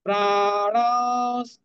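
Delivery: "প্রাণস" slowly intoned with a nasal "oun" before the s; nasalised vowel. Shown as a demonstration. Teacher showing the nasal sign, "প্রাণস" drawn out. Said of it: "সানুনাসিক উ".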